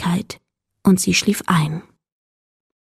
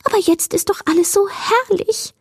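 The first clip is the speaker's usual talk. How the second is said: much higher, excited creature voice; delighted will-o'-the-wisp; 12 semitones higher than usual. A narrator's reading. Creature praising the night to Mila, high delight. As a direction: marvel at it in a high little voice. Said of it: "Das Irrlicht schwebte aufgeregt".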